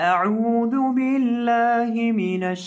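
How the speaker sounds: slow, high melodic chanting of the opening words; Quranic recitation. Shown as a demonstration. Teacher opening the lesson for students, slow melodic recitation.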